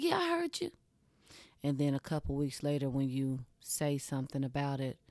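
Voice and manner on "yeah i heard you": high dismissive mock of a boyfriend; high mocking imitation of a distracted man. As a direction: toss it off like he's not listening. Man brushing off with quote.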